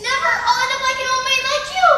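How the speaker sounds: loud tearful outburst; upset outburst. Acted. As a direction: blurt it out, upset.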